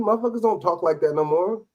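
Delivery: ordinary talk, nothing marked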